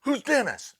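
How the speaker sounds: high, loud baffled question; can't believe it; startled question. Retold or imitated. Sheetrocker hearing about the ghost: high startled question. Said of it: "And I go".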